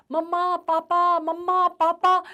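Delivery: put-on a lost child in the crowd yelling for his parents, slow and loud; child's shout. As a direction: yell for the parents with all his strength, drawn out. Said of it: "नहीं चिल्लाता तो".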